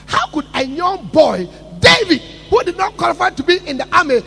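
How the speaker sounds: loud, high preaching cry; preaching shout. Acted. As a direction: thunder it from the pulpit. Preaching at full force.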